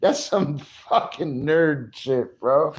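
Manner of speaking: high and giggly through laughter; laughing at himself. Real, not acted. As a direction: crack up at your own nerdiness. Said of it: "I'm so fucking drunk right now".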